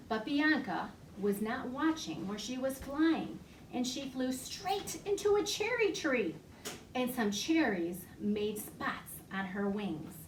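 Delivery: animated library storytime voice; reading to children. Acted. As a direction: tell it like a picture book for toddlers. Reads flannel story to children.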